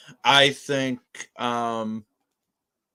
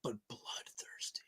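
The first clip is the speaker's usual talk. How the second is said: whispered emphasis; 21 dB quieter than usual. Shown as a demonstration. Co-host showing how to emphasize the episode title, whispered. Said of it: "to emphasize the title".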